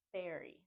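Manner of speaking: berry as the mistaken ending of library; first R dropped. Shown as a demonstration. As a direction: say berry with no R after the B. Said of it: "They drop the first R".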